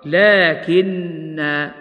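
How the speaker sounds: لكن stretched extremely slowly, the doubled nun held; slow emphatic لكن; merged lakinna. Shown as a demonstration. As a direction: drag out لكن, leaning on the nun. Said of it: "فصارت".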